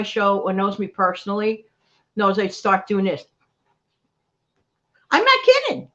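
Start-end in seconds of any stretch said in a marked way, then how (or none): 5.1-5.9 high, loud, insistent; emphatic insistence. Real, not acted. Host insisting to viewers about her nerves, high and loud. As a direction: swear you mean it.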